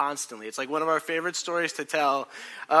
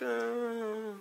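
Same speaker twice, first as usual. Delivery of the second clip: high, soft mock wail; softly faked crying moan; imitated crying; 8 semitones higher, 6 dB quieter than usual. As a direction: imitate a drawn-out whimper. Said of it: "she starts crying. She's just like".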